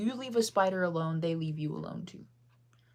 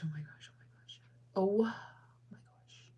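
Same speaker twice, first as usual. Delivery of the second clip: whispered exclamation; 2 semitones higher than usual. Real, not acted. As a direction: repeat it under your breath. Startled, repeats it under breath.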